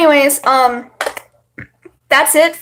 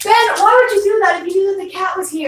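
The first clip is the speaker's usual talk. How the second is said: exasperated scolding; 8 semitones higher, faster than usual. Real, not acted. Scolding brother over cat.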